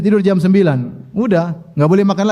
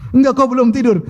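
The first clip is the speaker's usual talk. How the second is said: higher-pitched wifely protest; imitated insistent wife; 6 semitones higher than usual. Wife denying her husband slept, mock insistent. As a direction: contradict him as the coaxing wife, lightly acted.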